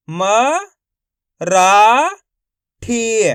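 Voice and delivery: "म", "रा", "ठी" apart, each held; long pauses between "म", "रा", "ठी"; syllable by syllable. Shown as a demonstration. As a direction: sound out the parts separately. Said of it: "direct मात्रा का उच्चारण करके".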